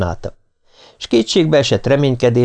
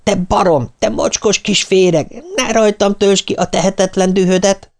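high-pitched furious outburst; angry cry; 10 semitones higher than usual. A narrator's reading. Nancy lashes out at Martin after slapping him, angry high cry. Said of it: "dühös kiáltása egy idegen nő kiáltása volt".

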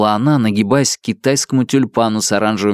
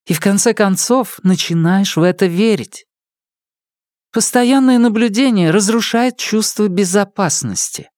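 female character voice; 9 semitones higher than usual. Acted. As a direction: read her lines as the heroine. Female character monologue, higher voice.

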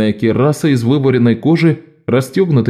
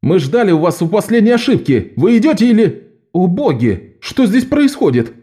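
alarmed, surprised; 8 semitones higher than usual. Acted. Аньеис врывается, видит рвоту.

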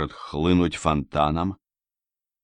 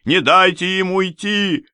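distant shout; 11 semitones higher, 4 dB louder than usual. A narrator's reading. Rubinstein ordering pursuit of the fugitives, distant shout. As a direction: call out as if from afar. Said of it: "кричал уже где-то вдалеке".

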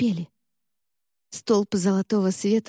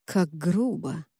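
slow, lowered, unimpressed remark; indifferent; 3 semitones lower, 4 dB quieter, slower than usual. A narrator's reading. Cardinal unmoved by the warrior's attack, indifferent. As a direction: brush it off with cool detachment. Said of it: "безразлично произнёс кардинал".

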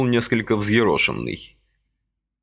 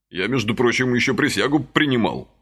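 usual speaking voice

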